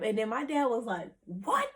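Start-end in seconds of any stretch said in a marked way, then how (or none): 1.2-1.7 high-pitched imitation of a stunned father; astonished dad. Dad learning it's a doctorate program, astonished. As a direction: pitch it up in amused disbelief.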